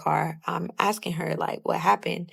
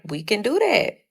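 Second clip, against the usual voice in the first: retold fight challenge, pitched up; higher-pitched, mildly cocky comeback; 5 semitones higher than usual. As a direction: take her up on a fight, in retelling. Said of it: "so it sounds funny coming out of my mouth".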